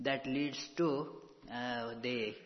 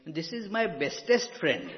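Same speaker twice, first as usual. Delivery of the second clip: mock childlike boast, louder, breaking into a laugh; quoting a child, laughing; 5 semitones higher, 8 dB louder than usual. The little one says it to me, laughing. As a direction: retell the kid's line while chuckling at it. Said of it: "next time he says to me".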